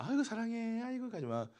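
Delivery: put-on high, cooing parent to a baby; imitating a parent fussing over a baby; doting parent. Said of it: "아기 때야".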